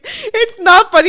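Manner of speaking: high, slow, laughing protest; real guilty laughter. Real, not acted. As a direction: protest while unable to stop laughing. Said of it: "I shouldn't laugh".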